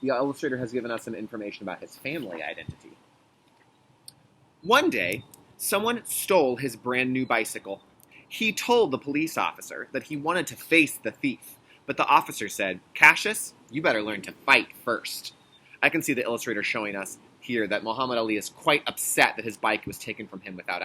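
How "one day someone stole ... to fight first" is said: louder storybook reading; reading to children. Acted. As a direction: read it like a picture book for kids. Reads the picture book aloud.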